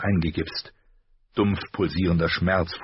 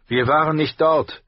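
higher, proud knight's voice, curt; stolzer Ritter; 10 semitones higher, 6 dB louder than usual. A narrator's reading. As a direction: answer like a proud sworn knight. (stolzer Ritter, höher und kühl)

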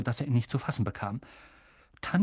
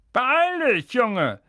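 high, loud impatient uncle calling; 10 semitones higher, 5 dB louder, much slower than usual. A narrator's reading. Uncle calls the boy from the kitchen, calling out. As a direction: shout it as if from another room. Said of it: "rief Onkel Vernon aus der Küche".

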